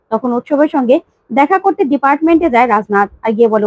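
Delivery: plain reading voice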